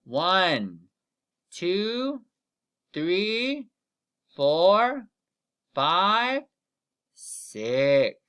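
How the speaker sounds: put-on slow count-along for kids; counting aloud. Shown as a demonstration. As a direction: count it out to the class. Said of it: "Count with me".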